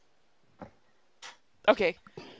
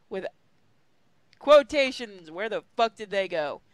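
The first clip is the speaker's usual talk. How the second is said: ordinary delivery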